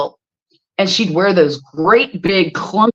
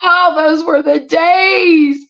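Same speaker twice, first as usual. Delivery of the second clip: laughing nostalgia; 8 semitones higher, 3 dB louder, much slower than usual. Real, not acted. Fond memory, laughing-crying.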